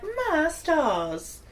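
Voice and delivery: high, drawn-out Southern lady. Acted. Host playing a Southern lady for laughs, hammed-up Southern accent. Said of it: "a Southern lady can be like".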